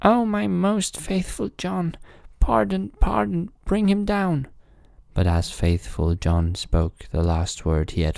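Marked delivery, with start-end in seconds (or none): 0.0-4.5 high, urgent cry for pardon; pleading royal cry, pitched very high; king crying out. A narrator's reading. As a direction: call out to stop the hanging. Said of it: "Then cried the king".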